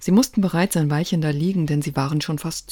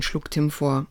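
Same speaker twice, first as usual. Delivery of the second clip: ordinary delivery, nothing marked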